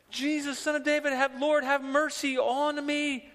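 mimicked crying out. Disciples mimicking the woman behind them, high mocking cry. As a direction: mimic her cries in exasperation. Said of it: "she's crying out after us".

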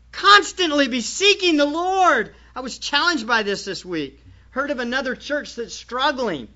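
ordinary talk

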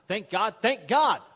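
slow, loud, rising command; building urging shout. Acted. Man urging the trotting horse to gallop, building shout.

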